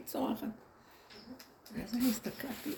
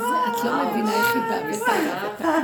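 crying shout; 13 semitones higher, 10 dB louder than usual. Retold or imitated. Quoted woman crying out.

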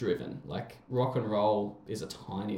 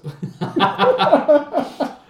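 big laugh; 12 dB louder than usual. Real, not acted. Laughing at the rock star story.

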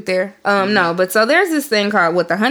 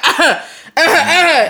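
mocking uh-huh; 7 semitones higher, 3 dB louder than usual. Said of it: "she's like".

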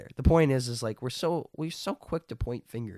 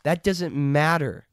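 raised, louder, emphatic dismissal; acted rebuke; 6 semitones higher, 7 dB louder than usual. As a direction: brush off the question forcefully as Jesus. Acting Jesus dismissing Peter's question.

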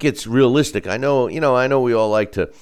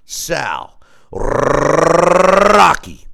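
big ring announcer intro, drawn out; ringmaster; 2 semitones higher, 6 dB louder, slower than usual. Pretend. Ring announcer style guest introduction.

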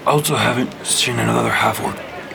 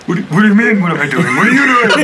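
loud, baffled comeback; indignant shout; 6 semitones higher, 6 dB louder, faster than usual. Acted. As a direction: throw the question right back at him. Thormac arguing back.

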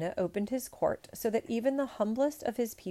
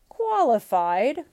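loud, high, drawn-out incredulous outrage; outraged cry; 5 semitones higher, 11 dB louder, slower than usual. A narrator's reading. Emperor to presumptuous suitor, outraged cry. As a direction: cry it out in slow disbelief. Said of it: "cried the outraged emperor".

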